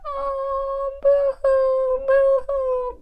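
high, drawn-out pretend wail; mock crying. Doll crying over her letters, hammed-up wailing. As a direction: wail boo-hoo like a sad little doll. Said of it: "Batsy is over there crying".

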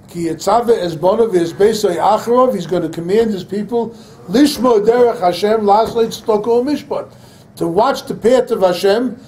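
fiery preaching. Acted. Rabbi preaching to the crowd about Am Yisrael, fiery and loud. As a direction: preach it, building fire.

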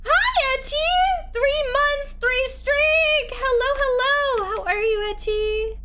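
high, loud, slow sing-song to a pet; gushing high greeting for a pet. Real, not acted. Greeting the cat companion.

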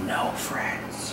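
breathy stage whisper. Acted. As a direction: whisper it behind his back. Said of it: "You don't have to whisper".

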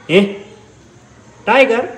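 calling the cat. Real, not acted.

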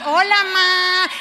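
saludo fingido. Retold or imitated. Finge sonrisa al llegar.

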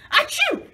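high explosive fake sneeze; a loud, high, hammed-up sneeze. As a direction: sneeze it out big. Gerald sneezes.

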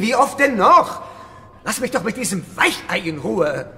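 high, loud irritated snap; angry outburst. Acted. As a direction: bite back in anger. Olaf snaps under questioning.

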